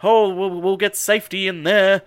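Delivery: loud, hammed-up panic; mock alarm. Recapping characters fleeing killer sheep, mock alarm. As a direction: play a corny horror-film alarm, loudly.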